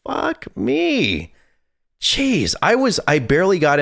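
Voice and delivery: high, squeaky disbelief; shocked disbelief. Real, not acted. Realizes game's age.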